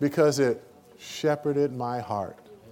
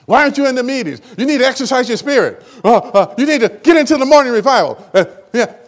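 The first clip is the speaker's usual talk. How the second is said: much higher and louder mock scolding, fast and laughing; hammed-up lawgiver nagging a wounded brother; 10 semitones higher, 9 dB louder, faster than usual. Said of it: "Do we go as a lawgiver?".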